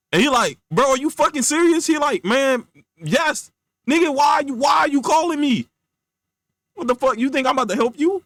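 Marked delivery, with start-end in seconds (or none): 0.7-1.9 high-pitched acted indignation; incredulous outrage. Casper confronting the friend who hung up, incredulous outrage. 2.2-8.2 high, agitated rant; exasperated refusal. Acted. Friend refusing Casper's call for help, exasperated.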